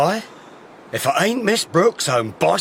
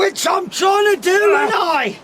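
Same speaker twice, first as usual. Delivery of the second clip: high, loud strained retort; strained shout; 3 dB louder than usual. Acted. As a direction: snap back while wrestling him. Struggle, strained reply.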